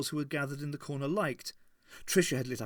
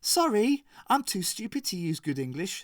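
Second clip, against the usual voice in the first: mock-sweet, high on the sorry; sugary sarcasm to tease her friend; sweetly sarcastic apology; 7 semitones higher, 4 dB louder than usual. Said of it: "smiled Tricia in a sweet voice".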